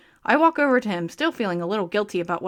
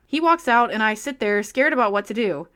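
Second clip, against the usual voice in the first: usual speaking voice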